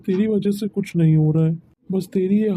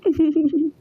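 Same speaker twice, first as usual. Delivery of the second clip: laugh; 10 semitones higher than usual. Real, not acted. Reacting to the aunt's changed tone.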